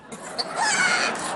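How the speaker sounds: high squealing cackle; shrieking laugh. Acted. To Hillary on the phone, shrieking laugh.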